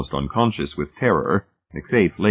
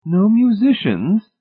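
very high, slow, louder astonished question; surprised; 5 dB louder, slower than usual. A narrator's reading. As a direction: ask in drawn-out, high-pitched surprise. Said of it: "Missus Pritchett asked in surprise".

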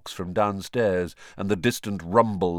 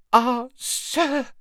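gasped; 6 dB louder than usual. A narrator's reading. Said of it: "he gasped".

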